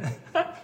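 small suppressed laugh; stifled giggles. Real, not acted. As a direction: giggle she can't hold in. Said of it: "前女神不要憋着笑了".

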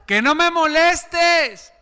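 high, drawn-out whine of refusal; annoyed refusal. Acted. Sleepy neighbor calling out from bed, high and fed up. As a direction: stretch it out, fed up.

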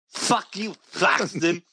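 high put-on voice of a friend; Crispy impression. Shown as a demonstration. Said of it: "Can you do an impression of Crispy right now?".

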